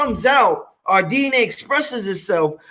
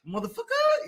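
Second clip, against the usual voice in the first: much softer, high rising curse; 9 dB quieter than usual. Shown as a demonstration. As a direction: drop it soft and rising. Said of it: "there's different ways we say this shit".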